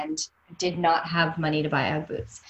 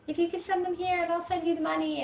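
coy request; 10 semitones higher, 3 dB quieter than usual. Retold or imitated. Acts out asking stranger for boots.

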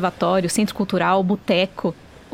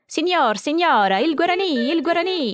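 higher, slow hawker's call; street vendor calling; 5 semitones higher than usual. Retold or imitated. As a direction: call out like a boy selling books. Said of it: "O menino gritava o nome dos livros".

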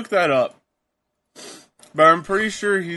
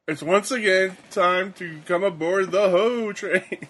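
drawn-out, higher-pitched wrestler's entrance call; catchphrase call; 4 semitones higher, slower than usual. Retold or imitated. Quoting The Godfather's catchphrase to a coworker, drawn-out call. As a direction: stretch it out like a slow ring announcement. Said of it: "I was like".

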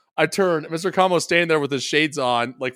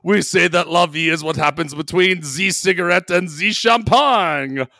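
mock French accent; 2 semitones higher, 5 dB louder than usual. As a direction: purr it like a cartoon Frenchman. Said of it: "word for word what I wrote".

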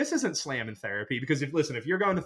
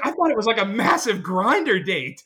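waitress realizing; 5 semitones higher, 4 dB louder than usual. Retold or imitated. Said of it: "She's like".

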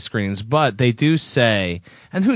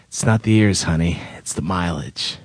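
movie quote; 8 semitones lower than usual. Acted. Co-host performing Raiders quotes, low world-weary hero line. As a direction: start firm, then drop low for the punchline. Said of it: "perform his top ten quotes".

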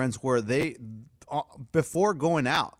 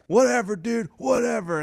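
higher, louder mock brush-off; dismissive retold brush-off; 8 semitones higher, 3 dB louder than usual. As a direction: imitate a guy giving up on a fight. Fighters giving up in retold fight.

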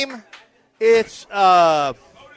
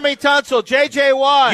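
high, raised crowd call; names called out high; yelling; 4 semitones higher than usual. Real, not acted. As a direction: yell the names out to the room. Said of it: "you two don't have to yell".

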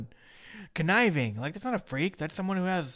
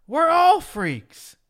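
loud, high, stretched-out declaration; imitated wrestler's big announcement; mock proclamation; 9 semitones higher, 9 dB louder, much slower than usual. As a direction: proclaim it slowly and grandly. Quoting Goldust's rallying proclamation.

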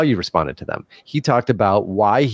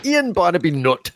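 higher mimicry of a co-host's accent; imitating Simon; 7 semitones higher than usual. Host shows his attempt at the listener's name, mimicking. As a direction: put on a co-host's accent for the surname. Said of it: "I was gonna do my".

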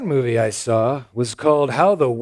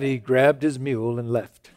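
ordinary delivery

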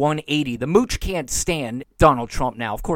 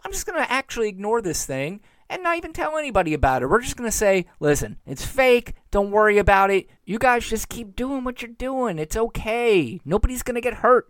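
high, breezy mock reassurance; mocking the president; 6 semitones higher than usual. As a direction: play it down like a smug president, lightly. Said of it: "So his strategy was".

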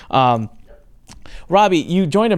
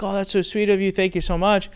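imitating a gushing daughter, pitched higher; grateful daughter; 7 semitones higher than usual. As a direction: thank him sweetly, voice lifted high. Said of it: "And her daughter's like".

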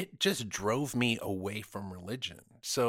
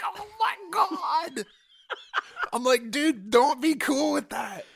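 much higher, loud, slow horrified cry; horrified plea; 7 dB louder, slower than usual. Retold or imitated. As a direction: plead in outraged disbelief. Retelling his horrified response.

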